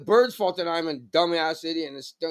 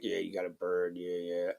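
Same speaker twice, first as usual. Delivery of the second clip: unimpressed; 9 semitones lower, 13 dB quieter than usual. Retold or imitated. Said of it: "are like".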